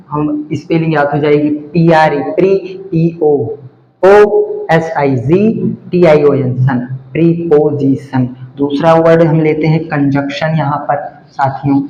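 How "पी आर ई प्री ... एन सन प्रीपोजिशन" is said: slow, careful dictation, ending on preposition; slowed-down teacher spelling out O S I G T I O N. Shown as a demonstration. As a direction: spell it out slowly, letter by letter, then say the word preposition. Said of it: "इस तरीके से preposition".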